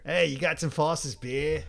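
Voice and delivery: hammed-up Aussie asking for a drink; mock Australian accent. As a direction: ask it in a put-on Australian accent. Acting out Australian Santa asking for beer.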